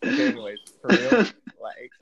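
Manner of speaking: high and laughing through the words. Real, not acted. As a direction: try to get back on track but keep cracking up. Laughing while trying to resume.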